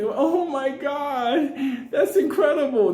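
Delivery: high, slow awed gasp; amazed gush. Retold or imitated. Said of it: "collapse their hands".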